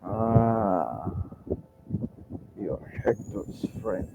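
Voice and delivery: low-key, very soft murmur; quiet realization. Acted. Merchant recognizes a friend's ally, hushed realization. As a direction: let it sink in softly.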